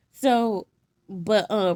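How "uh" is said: ordinary talk, nothing marked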